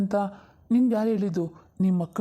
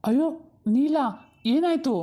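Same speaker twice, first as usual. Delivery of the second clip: alarmed; 6 semitones higher than usual. Acted. Sees the maid vomiting.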